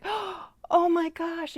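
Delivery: slow, high, breathy oh my gosh in wonder; amazed gasp. Retold or imitated. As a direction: relive being struck by it, high and stretched. Retold moment of being struck.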